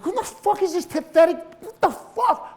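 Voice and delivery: very high, strained incredulous outburst; appalled disbelief. Retold or imitated. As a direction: re-enact disgusted shock at yourself. Disgusted shock at seeing himself.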